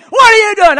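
high, full-volume mock outrage; shouted challenge. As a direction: yell it as the man rushing over. Acts out angry man's challenge.

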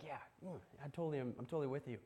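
low-key, murmured assent; very soft, offhand nodding along; casual agreement. Acted. Voices listeners' easy agreement.

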